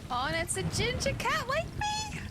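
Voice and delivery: very high delighted squeal; excited squeal. Real, not acted. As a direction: gush over the ginger cat. Delighted at ginger cat.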